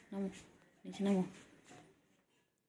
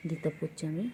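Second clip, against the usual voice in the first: usual speaking voice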